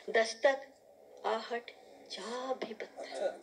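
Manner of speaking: hushed first takes, lifted repeats; spaced-out verse with deliberate pauses; measured Urdu nazm recital; poetry recitation. Acted. Recites her poem.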